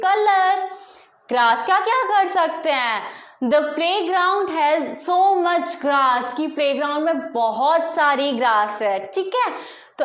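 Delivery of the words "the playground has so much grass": very slow, each word spaced out; teacher modelling a sentence; slow model sentence. Shown as a demonstration. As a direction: read it out slowly for the class. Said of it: "तेज से बोलना है".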